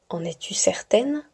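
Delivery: soft unvoiced whisper. Mysterious voice questioning Stella in the dark, whispered. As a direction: breathe the question like a hidden voice. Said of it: "répéta la voix".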